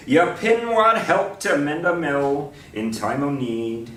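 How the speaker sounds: steady, declaiming verse reading; reading Scots poetry aloud; poem recital. Pretend. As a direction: give the lines like a Burns Night toast. Continues the Burns poem reading.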